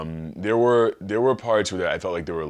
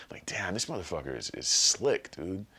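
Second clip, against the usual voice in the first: whispered aside; 5 dB quieter than usual. Real, not acted. Aside about Obama.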